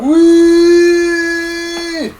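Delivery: high, loud shout of victory; triumphant cheer. Real, not acted. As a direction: yell out the win. Cheering as the boss dies.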